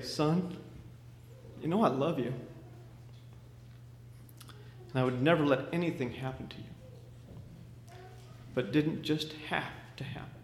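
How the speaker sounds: soft, tender goodbye fading away; tender farewell. Acted. Father saying goodbye to his son, soft tender voice. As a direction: hold back tears, gently.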